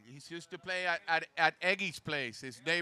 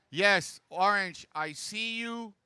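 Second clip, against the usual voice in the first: upbeat PA call to surfers, higher and louder; announcer voice; 4 semitones higher, 5 dB louder than usual. Real, not acted. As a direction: call out the standings over the beach. Announcing heat standings on mic.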